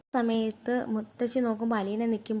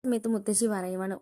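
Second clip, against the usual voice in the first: ordinary delivery, nothing marked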